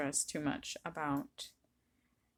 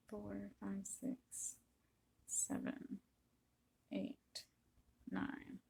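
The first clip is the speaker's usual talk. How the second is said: counting under breath; 2 semitones higher, 10 dB quieter, slower than usual. Real, not acted. Counting stitches while knitting.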